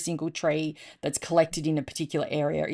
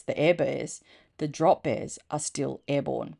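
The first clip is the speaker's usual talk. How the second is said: usual speaking voice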